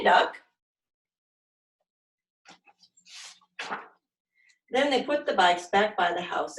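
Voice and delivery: put-on lively, expressive read-aloud for little kids; animated children's story narration; storytime reading. A narrator's reading. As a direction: read it like a silly picture book to a class. Reading picture book to children.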